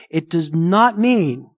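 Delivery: slow, with "not" hit hard; emphatic stress. Real, not acted. Pastor insisting to the congregation, stress on "not". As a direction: lean on "not" slowly and firmly. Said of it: "I'm very emphatic here".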